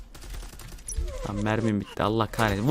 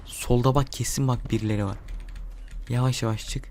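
ordinary delivery

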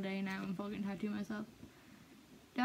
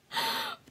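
sharp high gasp. Real, not acted. Shock at own tattoo.

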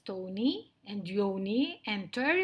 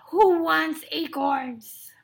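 loud, slow, raised scornful question; disdainful lion; 5 semitones higher, 10 dB louder, slower than usual. Acted. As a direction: draw it out in loud contempt. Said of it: "sniffed Lionel".

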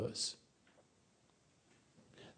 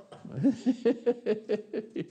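real laugh, high and bright; a laugh of self-amusement, pitched high; 11 semitones higher than usual. Real, not acted. Laughs admitting he's no angel.

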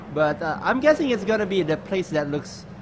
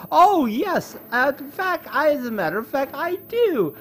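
much higher, gleeful boast; mock excited; 11 semitones higher, 3 dB louder than usual. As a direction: gush with fake excitement. Mock boast about transforming.

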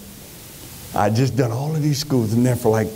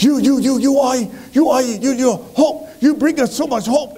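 high-pitched, excited retelling of grateful students; 10 semitones higher than usual. Retold or imitated. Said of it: "they would be like".

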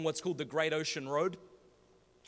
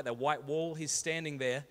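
ordinary delivery

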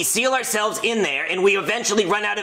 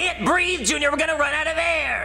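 panicky; 8 semitones higher than usual. Acted. Trapped, fearing no air.

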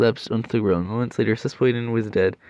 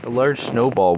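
loud; 8 dB louder than usual. Acted. Reading a dramatic moment aloud.

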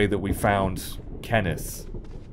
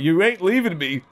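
clingy pleading; 8 semitones higher, 4 dB louder than usual. Retold or imitated. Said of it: "I'd be like".

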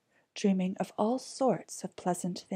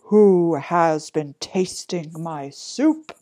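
loud, slow big bear; big bear's loud, drawn-out question; great big voice; 9 dB louder, slower than usual. A narrator's reading. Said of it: "he cried in a great big voice".

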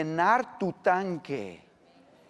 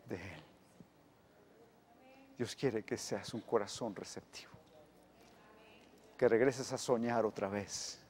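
much softer, lower, tender appeal; preacher softly urging hearts to open; soft prayerful preaching; 6 semitones lower, 7 dB quieter than usual. Acted. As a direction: draw them in gently.

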